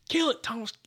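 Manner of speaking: high-pitched retold alarm; urgent plea. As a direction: reenact begging a friend to swat it. Urgent plea to friend over hornet.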